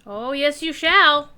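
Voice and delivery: loud, rising mock threat; mock taunt. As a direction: shout back a playful taunt. Taunting the boss back.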